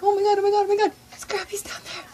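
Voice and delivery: high, rapid-fire oh-my-gods, loud at first; giddy retold fan panic; starstruck gushing. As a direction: squeal as if spotting a star. Retells excited spotting of Scrappy.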